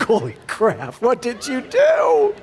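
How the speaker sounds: comic appalled disbelief, slowing and rising; acted parental shock. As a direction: voice leaps up high on a drawn-out outburst. Reacting to son's confession.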